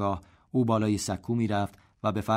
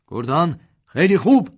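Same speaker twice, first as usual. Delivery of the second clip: loud, much higher shout; shout of praise; 8 semitones higher, 12 dB louder than usual. A narrator's reading. General shouts praise to the battalion, loud and high. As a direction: call out your praise across the parade ground. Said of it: "تیمسار داد زد".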